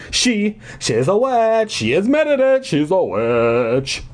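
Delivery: high, strained, drawn-out screech; shrieked. A narrator's reading. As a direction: screech the accusation repeatedly. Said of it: "Judith shrieked".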